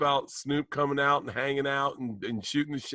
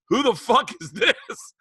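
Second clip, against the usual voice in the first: high, incredulous retold question; mock bafflement; 7 semitones higher than usual. Chappelle seeing a stranger on FaceTime, mock baffled. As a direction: demand it in comic disbelief.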